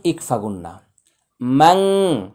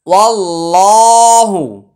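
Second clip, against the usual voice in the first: slow, drawn-out chanted recitation, slightly higher; Quran recitation; 6 semitones higher, 4 dB louder, much slower than usual. Acted. Tajweed teacher reciting the verse for students, slow melodic chant.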